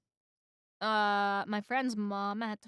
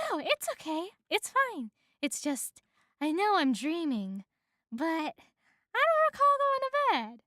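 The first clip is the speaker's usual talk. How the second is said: higher-pitched, wondering woman; reassure, pitched up and bewildered; flustered woman; 6 semitones higher than usual. A narrator's reading. Woman thinks she is dreaming, bewildered high voice.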